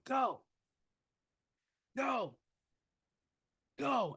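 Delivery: high-pitched, spaced-out start calls; imitated team call. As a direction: imitate players calling each sprint start. Team calling out during liners.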